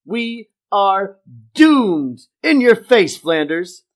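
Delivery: loud, high whoop into triumphant taunting; gloating Homer. Acted. Homer was right, not Flanders, loud gloating. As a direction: crow over a rival, stretching the words.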